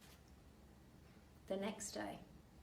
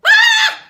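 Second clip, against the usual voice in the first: very high, loud shriek; scream; 32 dB louder than usual. Acted. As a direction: scream as frog hits your face. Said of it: "she screamed again".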